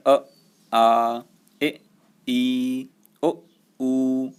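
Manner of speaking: अ, आ, ई, ऊ each said cleanly and separately; vowel demo. Shown as a demonstration. Teacher models each vowel for students to repeat. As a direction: sound each vowel out with open, unblocked breath. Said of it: "जैसे आप बोल के देखेंगे".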